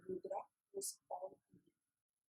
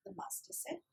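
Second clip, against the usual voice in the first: usual speaking voice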